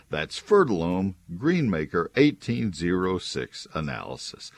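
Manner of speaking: upbeat radio ad read; chipper 1950s ad. Acted. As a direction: sell it like a cheerful announcer. Live read ad for sponsor.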